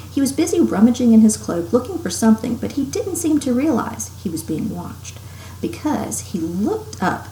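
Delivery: ordinary delivery, nothing marked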